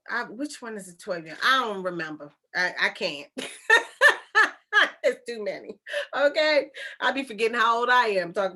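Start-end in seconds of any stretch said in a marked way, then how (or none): 3.3-5.0 high, loud burst of laughter. Real, not acted. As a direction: laugh out loud at your own muddle. Laughs at own forgetfulness.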